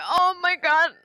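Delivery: high, emphatic "oh my God"; overwhelmed exclamation. Acted. Saf seeing Paula while facing death, overwhelmed exclamation. Player voices Saf's stressed reaction.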